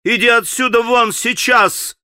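a high, firm order; young man commanding his brother to leave; commanding shout. A narrator's reading. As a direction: order him out with authority. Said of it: "властно крикнул Алёша".

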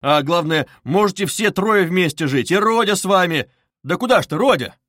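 urgent pleading. Acted. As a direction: press them urgently to stay together. Agitated pleading with departing Родя.

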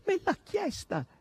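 very high, drawn-out exclamation; crowing excitedly. A narrator's reading. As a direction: crow it high and slow. Maid crows about a proposal. (esclamazione acutissima e trionfante)

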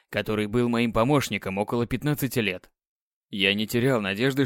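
ordinary delivery, nothing marked

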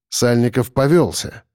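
plain reading voice

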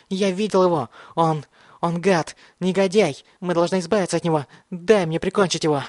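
high, crazed accusation; frantic yelling. A narrator's reading. Mad boy begs to finish off Thomas, frantic high raving. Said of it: "заорал Бен".

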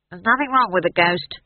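curt, testy snap; snapping. A narrator's reading. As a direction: snap back defensively. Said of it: "snapped Howell".

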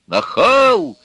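loud, high, indignant yell; shouted insult. A narrator's reading. Nazarov yelling at his stubborn relative, loud outburst. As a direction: hurl it at him at full volume. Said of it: "закричал опять Назаров".

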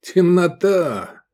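smirking. A narrator's reading. Искандер teases his ignorant friend, smirking. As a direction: stretch the word out with a smirk. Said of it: "ухмыльнулся Искандер".

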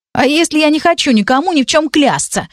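high, sulky pouting voice; high-pitched stubborn challenge; pouting defiance. A narrator's reading. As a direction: dig in petulantly with a pout. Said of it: "выпятил губу Стайль".

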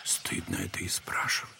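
a low whispered reproach; hushed rebuke. Acted. Elder scolding Prokhorovna for her sinful idea, whispering. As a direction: hush her gently, barely voiced.

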